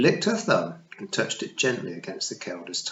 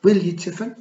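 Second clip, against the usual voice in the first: high, loud tiny pixie matriarch; a tiny Scots fairy queen, pitched high; Kelda voice; 10 semitones higher, 7 dB louder than usual. Acted. Kelda speaks to Tiffany.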